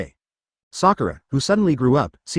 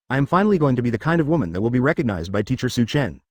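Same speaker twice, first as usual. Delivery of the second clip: ordinary delivery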